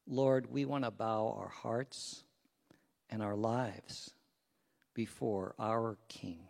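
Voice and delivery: low, soft, measured praying voice; prayer. Real, not acted. Pastor leading congregation to God before the lesson, prayerful. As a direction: pray reverently with unhurried pauses.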